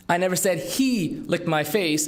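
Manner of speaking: "he" hit hard, the rest even; single out "he" as the licker; stress on HE. Shown as a demonstration. Speaker demoing word stress to an audience, stressing "he". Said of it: "emphasize different words".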